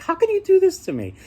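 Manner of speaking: a distraught princess crying out at her father; upset daughter. Retold or imitated. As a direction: daughter wails at the king for marrying her off, high and loud. Daughter protests to father king.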